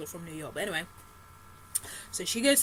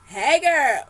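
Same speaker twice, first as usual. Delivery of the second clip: loud, high flirty greeting; flirty Dre; 7 semitones higher, 14 dB louder than usual. Pretend. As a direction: call her up all smooth and loud. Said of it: "No, really, he didn't say that".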